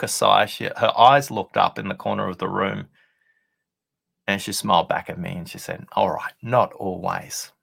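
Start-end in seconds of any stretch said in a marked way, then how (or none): none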